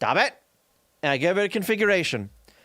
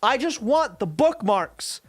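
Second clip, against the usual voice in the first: exasperated; 7 semitones higher than usual. Real, not acted. Ranting about unwanted font caching.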